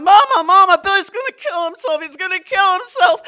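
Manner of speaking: loud high panicked screaming; screaming for mother. Retold or imitated. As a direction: scream for Mama in terror. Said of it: "I went screaming".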